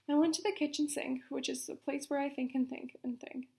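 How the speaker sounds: usual speaking voice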